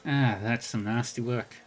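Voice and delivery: lilting Irish brogue, easygoing; a friendly Irish arcade regular, talked lightly; lyrical Irish accent. Acted. GM voices Rainbow.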